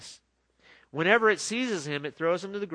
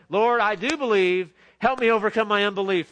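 loud, high, pleading prayer; preacher crying out to the Lord; earnest plea; 5 semitones higher, 9 dB louder than usual. Acted. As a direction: pray it out loud as your own cry. Preacher prays the line himself.

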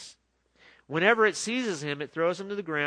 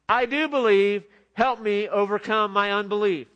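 loud, high, pleading cry of a desperate father; a father crying out to Jesus; 5 semitones higher, 8 dB louder than usual. Acted. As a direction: cry it out, begging for help.

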